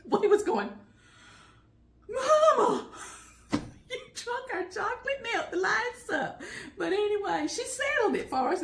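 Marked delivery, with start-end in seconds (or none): 2.1-6.4 high, loud laughing whine; kids' outraged protest. Retold or imitated. Children protesting to Mama, high and loud with laughter. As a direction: cry out like a cheated child, half laughing. Kids protesting mother drank milk.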